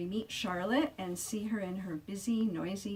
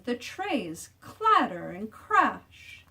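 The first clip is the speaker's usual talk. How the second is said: loud; 3 semitones higher, 7 dB louder, much slower than usual. A narrator's reading. Noises acted out.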